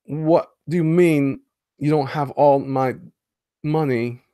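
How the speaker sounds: slow, halting Walken menace; a mock-threatening Walken line; Christopher Walken impression. Streamer quotes a Walken movie line, slow and menacing. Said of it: "he was excellent at the walk-in voice".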